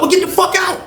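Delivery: high shouted order; mock shout. Cellmate shouting his roommate out, a high mock shout. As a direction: yell him out the door.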